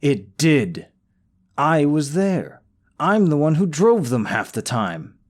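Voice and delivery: loud, high, heated rebuttal; heated accusation. Acted. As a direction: snap back at the rival. Accusing rival, raising voice.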